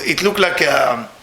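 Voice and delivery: ordinary talk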